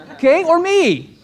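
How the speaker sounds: high, slow and smug. Pretend. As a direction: present yourself as the obvious choice. Selling himself to the client.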